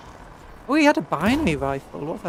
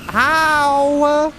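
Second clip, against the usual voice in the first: high, loud disbelieving "how"; exasperated shout; 10 semitones higher, 8 dB louder than usual. Real, not acted. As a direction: yell in disbelief at the screen. Outraged at overpowered enemy turrets.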